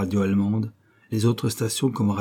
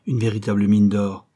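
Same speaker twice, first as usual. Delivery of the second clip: plain reading voice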